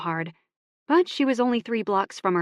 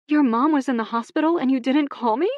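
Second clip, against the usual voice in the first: higher, louder disbelief; incredulous outburst; 7 semitones higher, 5 dB louder than usual. Acted. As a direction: stand up stunned and hurt. Emmy stands up, incredulous.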